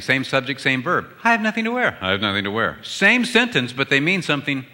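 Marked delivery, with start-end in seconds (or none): 1.2-2.0 high mock-wife voice, stress on I; wife's version of the line, said high. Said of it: "they both said the same thing". 2.0-2.8 lower, plain husband voice, stress on wear; husband's version of the line, said low and plain. Retold or imitated. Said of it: "they both said the same thing".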